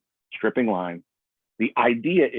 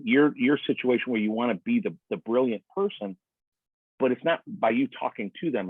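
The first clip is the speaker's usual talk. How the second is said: ordinary delivery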